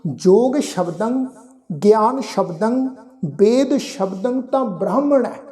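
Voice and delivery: slow, measured phrase-by-phrase scripture reading; recitation demo. Shown as a demonstration. Teacher asks listeners to hear the reading, slow recitation. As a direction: recite slowly with a held ending on each phrase. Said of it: "ਫਿਰ ਤੋਂ ਉਚਾਰਨ ਸੁਣੋ ਜੀ".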